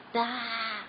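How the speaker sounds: a worried bleat; sheep bleat. A narrator's reading. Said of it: "blatted the sheep".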